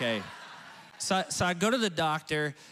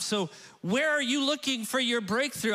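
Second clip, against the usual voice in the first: raised refrain; 8 semitones higher than usual. Acted. Pastor challenges the congregation, raised and energetic.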